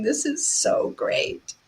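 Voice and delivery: hushed, high-pitched thrill; acted breathless excitement, drawn out. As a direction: savor it slowly, acting out giddy delight. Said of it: "you're so excited".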